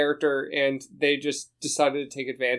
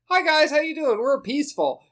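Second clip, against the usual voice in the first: higher, lightly voiced friendly cultists; cheery cult greeting; 11 semitones higher, 4 dB louder than usual. DM voicing whale cult greeting the party, lightly higher and cheery. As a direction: greet them sweetly and harmlessly. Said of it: "the cult is like".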